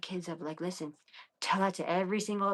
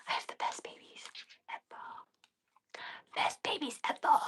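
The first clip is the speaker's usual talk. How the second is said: higher whispered "Best babies ever."; 11 semitones higher, 5 dB quieter than usual. Real, not acted. Bragging about her kids in a high whisper. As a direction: breathe it out, gushing.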